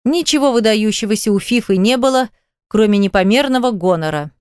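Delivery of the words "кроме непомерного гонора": ordinary delivery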